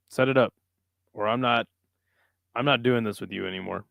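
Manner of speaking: ordinary talk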